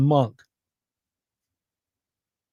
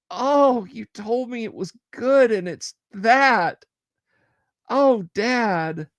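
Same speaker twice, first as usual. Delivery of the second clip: a lightly voiced dog, higher, let down and hurt; disappointed dog voice; 7 semitones higher, slower than usual. Streamer voicing his dog, let down by his dad, in a higher voice. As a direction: play the sad dog betrayed by the banana, higher. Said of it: "just so disappointed".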